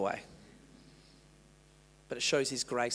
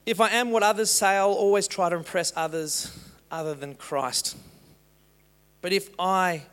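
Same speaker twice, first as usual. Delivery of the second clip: loud emphatic quote reading; 5 semitones higher, 7 dB louder than usual. Acted.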